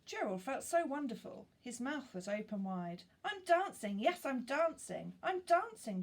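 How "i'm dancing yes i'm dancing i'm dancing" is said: much higher and louder, bursting with joy; joyful cry. A narrator's reading. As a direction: shout it out in delight at finally dancing. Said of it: "Gerald cried".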